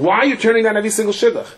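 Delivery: high, fast, exasperated demand; exasperated uncle. Acted. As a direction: act out an uncle at the end of his patience. Uncle quarrels with his niece.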